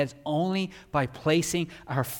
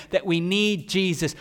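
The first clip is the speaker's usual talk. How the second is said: ordinary talk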